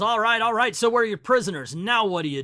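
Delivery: loud, high Superman bellow; angry yell. Acted. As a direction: shout him down in anger. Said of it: "An angered Superman yells".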